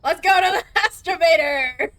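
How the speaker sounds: loud mocking yell breaking into laughter; laughing shout. Real, not acted. Gamer mocking the elevator's name, laughing loud yell. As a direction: bellow it while cracking up. Mock repeat of the word.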